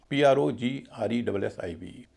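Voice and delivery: ordinary talk, nothing marked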